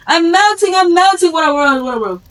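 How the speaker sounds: high, loud wail sinking away as she melts; melting witch scream. Acted. Witch doused with water, screaming and fading. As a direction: scream it out, then fade downward. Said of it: "she started screaming".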